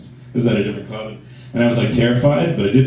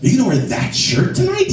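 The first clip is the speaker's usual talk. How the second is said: put-on high, disapproving girlfriend voice; nagging girlfriend; 8 semitones higher than usual. Girlfriend questions his shirt before going out, disapproving. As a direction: pitch up in mock horror at the outfit. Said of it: "suddenly she'd be like".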